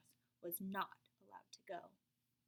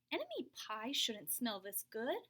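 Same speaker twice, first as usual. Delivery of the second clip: ordinary delivery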